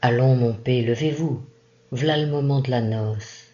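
usual speaking voice

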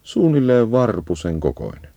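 ordinary talk, nothing marked

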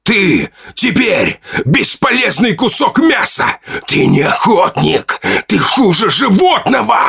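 high, slow, raging denunciation; furious, disappointed cry. A narrator's reading. Father turns his rage on crippled son, furious. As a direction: cry out in anger and bitter disappointment. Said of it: "крик его сути наполнился гневом и разочарованием".

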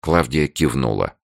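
ordinary delivery

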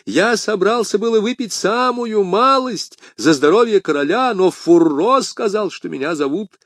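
very high, pleading servant; high-pitched, anxious self-defence; flustered excuse. Acted. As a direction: make frightened excuses to your master. Scared servant excuses himself to master.

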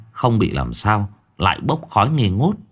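usual speaking voice

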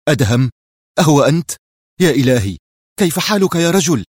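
loud, high cries of delighted surprise; overjoyed to see an old friend; surprised joy. A narrator's reading. Shimon greets Adham loudly, amazed and overjoyed. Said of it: "هاتفا في مزيج من الدهشة والسعادة".

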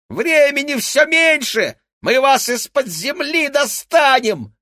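very high, loud, slow threat; yelling. A narrator's reading. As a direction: screech the threat. Rat still yelling after fugitives.

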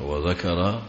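"وذكر" with a after dhal and after kaf; two fathas. Shown as a demonstration. Teacher shows the verb reading "وذكر", wa-dhakara with open a's. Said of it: "يعني بفتحتين".

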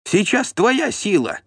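high, slow defiant squeal; squealing yell. A narrator's reading. Helpless steward challenging the voivode, squealing. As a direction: cry out shrilly in defiance. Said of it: "визгливо закричал Тиун".

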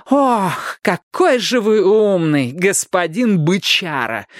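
high, slow mocking sing-song; mock them with poisonous sweetness; drawn-out sarcastic jeer; venomous mimicry. A narrator's reading. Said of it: "ядовито передразнила Пиппа".

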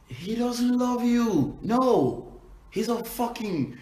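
high, loud, drawn-out protest; indignant outburst. Real, not acted. Telling a beaten girlfriend he doesn't love her, indignant. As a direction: cry out in slow, high-pitched disbelief. Outburst at excuse for abusive boyfriend.

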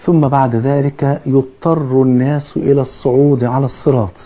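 ordinary delivery

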